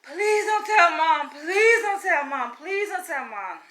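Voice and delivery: high, loud, slow pleading; loud desperate begging. Retold or imitated. Begging sister not to tell Mom, loud slow plea. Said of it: "I remember just begging".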